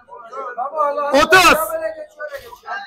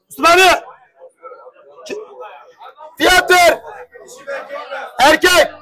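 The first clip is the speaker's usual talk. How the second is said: calling out. Real, not acted. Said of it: "Abi bağırıyorum".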